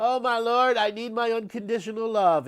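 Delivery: high, loud, overwhelmed exclamation; ecstatic cry of surrender. Acted. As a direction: cry out in sudden rapture. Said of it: "you go".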